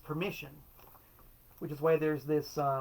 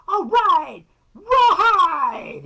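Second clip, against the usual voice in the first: hammed-up, much higher, much louder cheer; mock rock star cheer; 14 dB louder, much slower than usual. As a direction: hype the crowd like a cheesy frontman.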